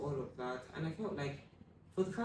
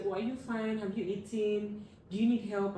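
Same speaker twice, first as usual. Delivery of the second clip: higher, louder sugary fussing questions; mock fussing concern; 6 semitones higher, 5 dB louder than usual. Schoolmates fussing over the grieving girl, mock sweet concern. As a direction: imitate classmates overdoing their kindness.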